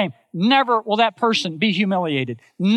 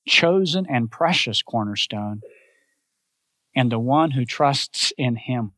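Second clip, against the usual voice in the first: ordinary delivery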